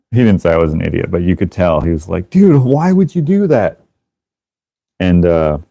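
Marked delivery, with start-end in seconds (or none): 2.3-3.8 high, exasperated imitation; incredulous reproach. Friend to the culprit, incredulous reproach. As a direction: plead in mock disbelief, pitched up. Friend scolds him in story.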